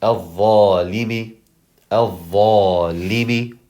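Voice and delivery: slow Al-dhalimi, held dh and long aa; Al-dhalimi with heavy doubled dh; model pronunciation. Shown as a demonstration. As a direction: stretch dhaa in al-dhalimi. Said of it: "that's how you pronounce this".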